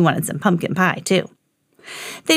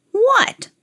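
lightly voiced old man, high questioning cry; old man asking. A narrator's reading. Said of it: "asked the old man".

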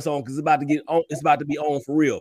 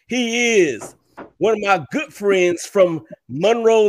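slow, big game-show guest introduction; announcer intro; 3 semitones higher, 3 dB louder, slower than usual. Acted. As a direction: build up the guest like a ring announcer.

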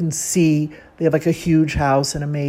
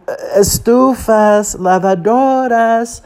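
slow, higher vendor call; street-vendor tape; 6 semitones higher, 4 dB louder, much slower than usual. Retold or imitated. Listing appliances as the tape does, drawn-out vendor chant. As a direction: stretch each item out like a recorded hawker. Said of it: "much worse than that tape".

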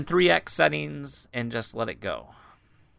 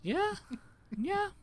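squeaky high yeah; 4 dB quieter, slower than usual. Pretend. Mock squeaky yeahs.

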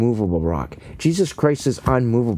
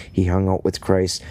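normal talking voice

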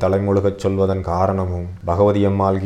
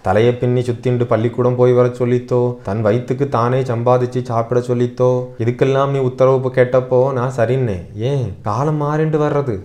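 high, pleading grandmother's voice; emotional old mother's appeal, higher throughout; impassioned grandmother; 5 semitones higher than usual. Acted. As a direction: plead with your son with aching resolve. Narrator voices grandmother's impassioned speech.

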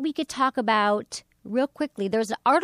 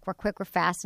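ordinary delivery

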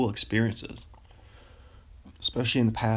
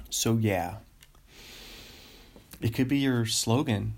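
ordinary delivery

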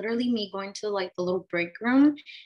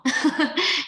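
short real laugh; 7 semitones higher, 4 dB louder than usual. Real, not acted. Laughs at husband's compliment.

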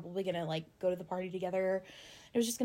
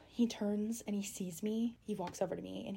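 whispered suspense; 7 dB quieter than usual. Real, not acted. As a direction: draw them in with a whisper. Tense moment, told.

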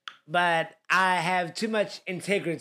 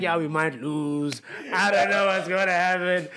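mock gloomy Sid; 2 semitones lower than usual. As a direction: play a friend pretending to fear a loss. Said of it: "Sid's like".

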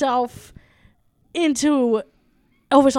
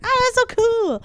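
excited quote; 10 semitones higher than usual. Retold or imitated. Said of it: "And I was like".

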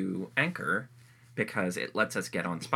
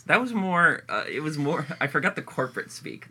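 laughing; 7 semitones higher, 4 dB louder than usual. Real, not acted. Laughing while talking.

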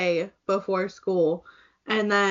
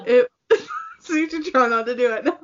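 talking through giggles, pitched high; high, giggly warning; laughing; 5 semitones higher, faster than usual. Real, not acted. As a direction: tease while laughing. Laughing while teasing friend.

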